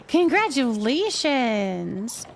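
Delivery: normal talking voice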